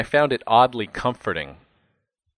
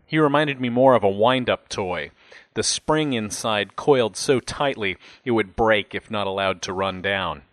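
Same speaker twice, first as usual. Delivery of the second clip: usual speaking voice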